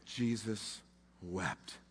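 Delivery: soft and low, each word set apart; hushed reverence. Acted. Preacher to congregation, a hushed low reading. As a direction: let the verse sink in, hushed. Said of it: "this single verse".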